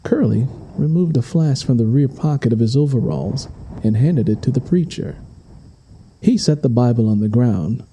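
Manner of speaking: ordinary delivery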